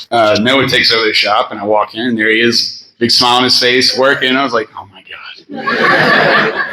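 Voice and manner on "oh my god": whispered, slow, laughing disbelief; stunned, laughing under your breath; breathy laughing oh my God. Retold or imitated. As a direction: let the laugh swallow the words. Seeing him grown up at shop.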